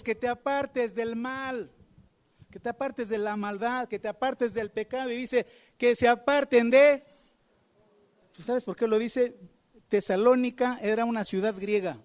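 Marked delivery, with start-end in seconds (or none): 5.8-7.0 proclaimed command. Acted. Preacher proclaims Paul's words.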